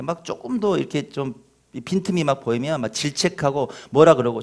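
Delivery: normal talking voice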